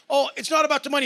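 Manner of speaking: mock-sincere, high on not; a hint of a self-righteous crowd; mocking what people say. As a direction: lightly parody a preachy excuse. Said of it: "this is what people say".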